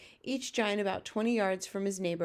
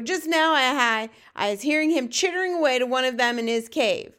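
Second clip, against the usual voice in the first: raised, higher giant voice; crying out; 4 semitones higher, 7 dB louder than usual. Acted. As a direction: call out an accusation loudly. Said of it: "cried the blood bottler".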